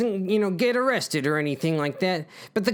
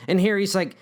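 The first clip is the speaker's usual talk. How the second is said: ordinary delivery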